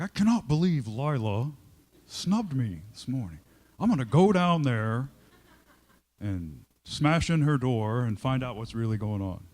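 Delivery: loud, indignant rant to himself; stewing in anger. Retold or imitated. Distracted in a meeting, loudly fuming about a coworker. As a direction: fume loudly over the snub. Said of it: "and I'm like".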